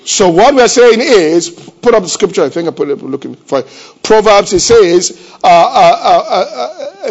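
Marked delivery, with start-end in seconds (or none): 0.0-2.4 loud, slow pulpit call; preaching at full force. Acted. Preacher to the congregation, calling for the scripture, loud and slow. 4.0-7.1 loud, slow pulpit call; preaching at full force. Acted. Preacher to the congregation, calling for the scripture, loud and slow.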